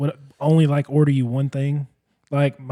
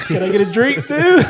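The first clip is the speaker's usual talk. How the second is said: put-on laughing kid ask; 10 semitones higher, 9 dB louder than usual. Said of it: "I'm like".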